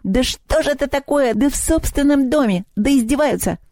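high-pitched indignant goblin lament; wailing house goblin. A narrator's reading. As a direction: complain in a high, put-upon goblin voice. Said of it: "завопил домовой".

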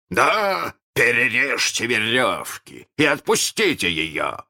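высокий командный окрик; отдай приказ через залив; властный приказ. A narrator's reading. Питер командует голосом Крюка. (приказ криком)

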